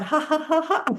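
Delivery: quick high ha's rolling into long laughter; laughing it out. Shown as a demonstration. Teacher laughs out the breath for class, high pealing laugh. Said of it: "you're just gonna laugh it out".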